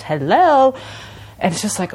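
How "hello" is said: high, loud sing-song chime; imitated ice cream truck chime. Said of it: "it keeps on repeating".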